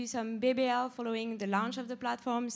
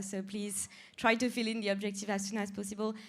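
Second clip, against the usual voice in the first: ordinary talk, nothing marked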